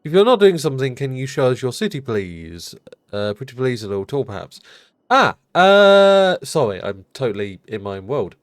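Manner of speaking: ordinary talk, nothing marked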